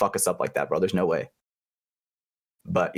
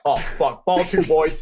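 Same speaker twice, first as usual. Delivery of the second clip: mock callout; 3 semitones higher, much slower than usual. Calling out during paintball play.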